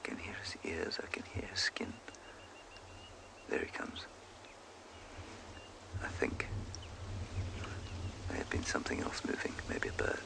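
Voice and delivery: hushed safari guide's whisper; real hushed whisper. Real, not acted. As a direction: whisper softly, listening out for an animal. Listening for an approaching elephant.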